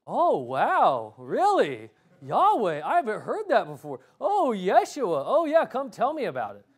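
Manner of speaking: exaggerated gullible wonder, pitch swooping up and down; mock amazement. Preacher mimicking people eager to hear some new thing, mock amazement. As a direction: gush as if wowed by some new revelation. Mocking people hungry for novelty.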